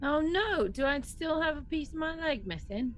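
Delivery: ordinary talk, nothing marked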